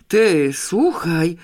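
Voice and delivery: loud, slow, feverish shout; excited shout. A narrator's reading. Pawełek bursts in shouting to Janeczka, feverishly excited. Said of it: "krzyknął niebotycznie rozgorączkowany".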